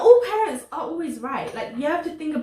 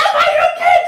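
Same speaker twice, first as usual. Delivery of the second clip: high, loud hammed-up outrage; mock angry; 9 dB louder, faster than usual. Friend overacting the girl's retort in the drama, mock angry yell. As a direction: yell it as an exaggerated scolding, half laughing. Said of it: "she doesn't say that angry".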